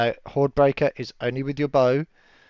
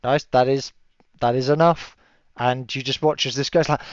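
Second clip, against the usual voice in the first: ordinary talk, nothing marked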